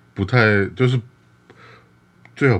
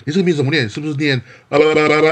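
higher, louder mocking voice, laughing through it; mocking rude person; 8 semitones higher, 5 dB louder, faster than usual. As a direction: ridicule the long name like a rude outsider. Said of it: "比如说". (嘲弄的语气，边笑边模仿无礼的人)

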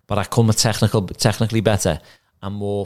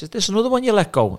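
much higher mocking voice; imitating the wife; 12 semitones higher than usual. Wife teasing her husband at wedding, high mocking voice. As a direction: tease him in a high mock voice. Manager's wife mocks him.

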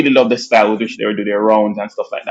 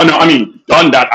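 ordinary delivery

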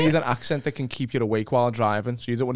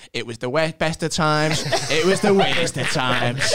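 Scouse Dickens reading, slowing at the end; hammed-up Scouse audiobook narrator; Scouse accent; 4 dB louder, slower than usual. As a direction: read it grandly in Scouse. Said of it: "like, a Scouse accent".

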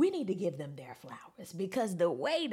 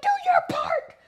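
high urging call; 9 dB louder than usual. Retold or imitated. Voicing the crowd urging [name], high loud urging.

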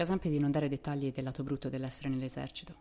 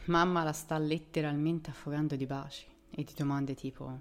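plain reading voice

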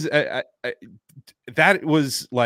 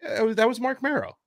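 pitched way up, offhand; high casual reply; 11 semitones higher than usual. Retold or imitated. Telling the coworker who called, high and offhand. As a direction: toss it off brightly in a high voice. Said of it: "And I was like".

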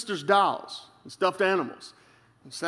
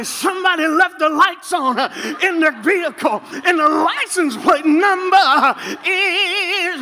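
high, shouted announcement with a laugh in it; preached announcement; 11 semitones higher, 6 dB louder, slower than usual. Retold or imitated. Mocking church announcements, high and shouted like preaching. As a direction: announce a parked car like a hollering preacher. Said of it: "they would even do the announcements like that".

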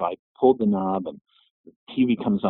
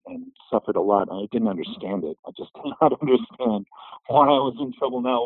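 ordinary talk, nothing marked

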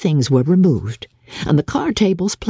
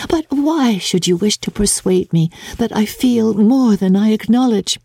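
higher, earnest sister pleading to be believed; earnest Jane; 7 semitones higher than usual. Acted. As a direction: insist you feel nothing, a touch too much. Jane teased, half laughing.

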